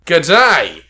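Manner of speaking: loud, high, exaggerated Australian; mock Australian accent. Co-host requested an Australian version, hammed-up Australian accent. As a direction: punch out each word in hammed-up Australian. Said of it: "say that in an Australian accent".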